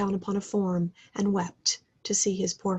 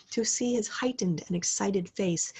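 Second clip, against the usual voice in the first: ordinary delivery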